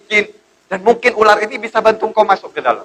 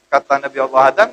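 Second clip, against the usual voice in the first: usual speaking voice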